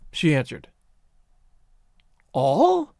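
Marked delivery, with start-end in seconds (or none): 2.3-2.9 high, rising disbelief; stunned, high-pitched query; vastly incredulous. A narrator's reading. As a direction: question it in astonishment, pitch leaping. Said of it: "vast incredulity in his voice".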